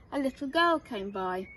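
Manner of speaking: usual speaking voice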